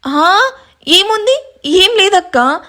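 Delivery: high, slow, flustered cover-up; nervous denial. Acted. Younger sister nervously hiding something from her elder sister. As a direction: stall with a jittery, too-high voice. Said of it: "టెన్షన్గా చూస్తుంది".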